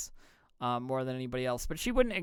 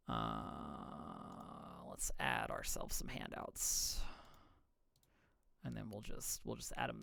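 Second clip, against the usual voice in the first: low unvoiced whisper to self; whispered aside; 6 semitones lower, 11 dB quieter, slower than usual. Real, not acted. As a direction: whisper it under your breath. Muttering aside while working.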